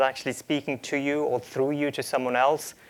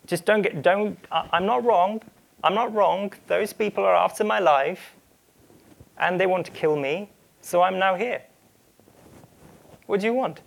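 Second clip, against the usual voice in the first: higher-pitched, defensive protest; 5 semitones higher than usual. Acted. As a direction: act out Elijah justifying himself. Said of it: "was a bit more defensive".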